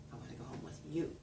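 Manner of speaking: soft, quick, "you" set apart; stress on "you". Shown as a demonstration. Lecturer repeating the example, soft stress on "you". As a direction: quietly land on "you". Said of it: "Stressed word, you".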